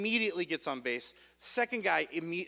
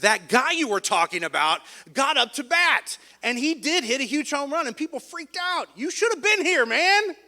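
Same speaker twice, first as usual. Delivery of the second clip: much higher and louder, teasing hype; gloating excitement; 9 semitones higher, 7 dB louder than usual. Retold or imitated. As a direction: rub it in with mock excitement. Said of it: "He did not think that was as funny".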